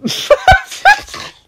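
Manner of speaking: tongue-tied giggle. Real, not acted. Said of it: "Why can't I say this?".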